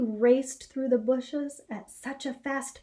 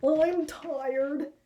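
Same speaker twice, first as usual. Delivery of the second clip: tired Harold; 5 semitones higher, 3 dB louder than usual. Acted. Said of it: "said Harold".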